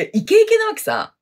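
ordinary talk, nothing marked

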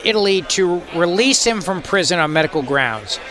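ordinary talk, nothing marked

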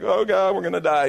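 imagine a tax collector panicking in a storm; panicked Matthew. Acted. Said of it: "he may have been like".

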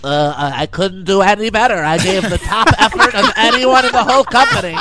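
puffed-up bragging worker; mock self-praising employee. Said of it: "you know how Laura answers every time".

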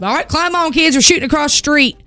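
hint of a dad calling out to the kids. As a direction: call the kids over, half shouting. Dad calling kids onto trailer.

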